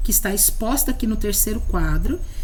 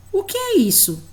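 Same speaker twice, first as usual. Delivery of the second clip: higher, bright teacher question; quizzing question; 10 semitones higher than usual. Acted. Teacher asks the child what the word is, higher. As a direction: ask it like a playful quiz.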